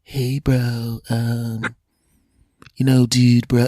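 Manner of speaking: soft, slow surfer voice; laid-back dude, trailing between words; California surfer dude. Retold or imitated. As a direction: imitate a mellow, hesitant surfer friend. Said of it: "he literally was like".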